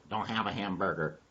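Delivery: low, soft answer; subdued denial. Acted. Dog answers donkey's food question, low soft denial. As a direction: drop the voice for the reply.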